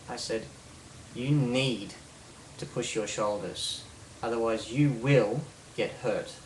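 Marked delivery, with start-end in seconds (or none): 1.1-6.3 firm instruction with "you will" punched; emphasis on power words. Shown as a demonstration. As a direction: lean on "need" and "will". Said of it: "These words that I'm putting emphasis on".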